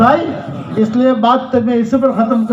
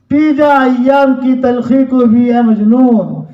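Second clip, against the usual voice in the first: poetic recitation; slower than usual. Acted. Preacher recites verse to Majnun.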